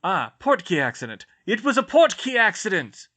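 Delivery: loud, high announcement to the crowd; loudly. Acted. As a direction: clear up confusion for everyone. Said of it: "Sirius said loudly".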